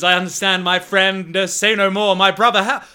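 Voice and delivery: high, earnest reassuring voice; Milos in character. Acted. Milos accepting a friend's plea, high earnest voice. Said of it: "And Milo says".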